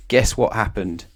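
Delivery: plain reading voice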